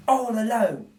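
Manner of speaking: mildly pitying thief; a touch of pity. Acted. Narrator voices Bill pitying the baby, lightly. Bill's voice in read story.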